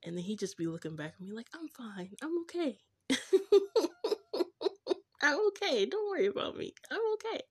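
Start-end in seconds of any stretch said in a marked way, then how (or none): 1.5-2.8 small voice climbing high; mock brave denial. Voices him denying he is upset. 5.2-7.4 high, loud put-on protest; mock brave denial. Voices tearful partner insisting he's fine.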